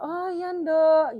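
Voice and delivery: a mother gently answering her daughter; lightly voiced loving mother; tender mother. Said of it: "gitu ya kan".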